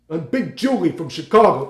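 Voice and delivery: Big Julie at modest volume; not yet loud enough. Retold or imitated. Friend auditioning for a musical, held-back character voice. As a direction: deliver the stage line without filling the room. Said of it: "you gotta be louder".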